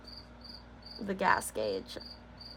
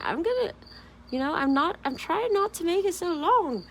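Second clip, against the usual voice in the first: much higher, pinched little voice; high mock-fretful voice; squeaky self-quote; 9 semitones higher, 4 dB louder than usual. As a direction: squeak it out like a fretting kid. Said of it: "'cause I was like".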